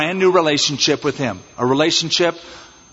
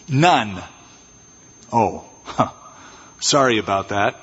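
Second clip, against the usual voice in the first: awkward exchange; 6 semitones lower than usual. Acted. Awkward reply about no children.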